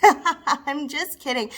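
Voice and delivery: slow words through a laugh; chuckling backtrack. Acted. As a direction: laugh off your own joke. Laughs off own donation joke.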